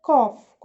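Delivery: "cough" loud, ending in an F sound; emphatic "cough", final F clearly sounded; correct pronunciation. Shown as a demonstration. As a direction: model "cough" for pupils with GH as F. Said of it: "ننطق الكلمة صح".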